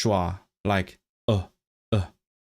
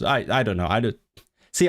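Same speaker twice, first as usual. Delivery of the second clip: ordinary talk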